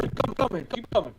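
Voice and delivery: normal talking voice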